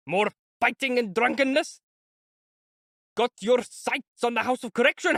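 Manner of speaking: high, raging tirade; angry lieutenant. A narrator's reading. Lieutenant berating the opulent lady in a rage. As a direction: berate her like a furious officer.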